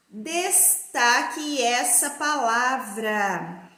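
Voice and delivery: syllable-by-syllable instruction; drawn-out, measured classroom reading; slow and deliberate. Shown as a demonstration. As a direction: read it out very slowly, spacing each part. Teacher reads instruction syllable by syllable.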